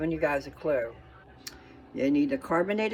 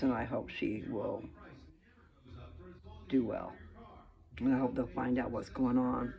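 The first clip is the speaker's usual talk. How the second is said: normal talking voice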